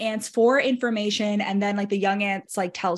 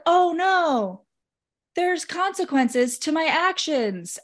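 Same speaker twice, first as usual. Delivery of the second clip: high, drawn-out mock "oh no"; sarcastic fake realisation, stretched out; mock dismay; 7 semitones higher, much slower than usual. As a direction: exaggerate the dismay, slowly and higher. Mocking a character's dawning realisation.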